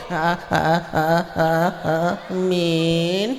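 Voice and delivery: low stammered ha then drawn amin; stuttering amin. Pretend.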